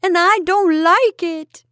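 put-on high, loud, drawn-out childish wail; long-drawn tearful complaint, pitched high; wailing boy. A narrator's reading. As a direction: wail it slowly like a scared village lad. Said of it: "wailed the boy".

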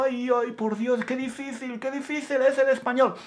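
high, whiny mock despair; panicked learner.

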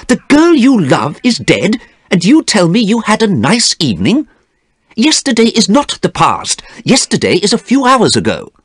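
high-pitched, indignant reproach; Basil, appalled. A narrator's reading. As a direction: confront a friend in shocked disbelief.

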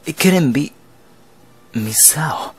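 breathy whispered name; shocked whisper. Acted. Realizing the curse.

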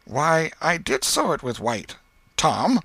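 sly aunt fishing for a confession; Aunt Polly prying. A narrator's reading.

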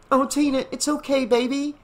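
high-pitched consoling mother. A narrator's reading. Mother trying to console her frightened daughter, high consoling voice. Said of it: "Missus Shepherd tried to console her".

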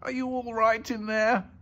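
high-pitched motherly call. Acted. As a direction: call anxiously to someone shut away. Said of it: "she called".

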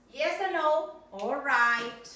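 ordinary delivery

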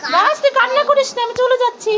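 put-on a lightly squeaky kid voice, consoling; comforting child figure.

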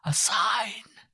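breathless high whisper begging; whispered imploring. Acted. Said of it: "she implored".

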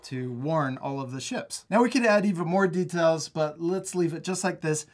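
normal talking voice

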